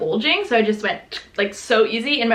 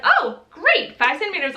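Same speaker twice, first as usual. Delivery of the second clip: surprised; 7 semitones higher than usual. Retold or imitated. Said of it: "she was a little surprised".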